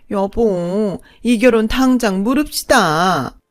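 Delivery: high, loud indignant shriek; shrill offended outburst. Acted. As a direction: play the outraged stepmother. Second mother shrieks offended. (높고 큰 소리로 분개하는 둘째 어머니)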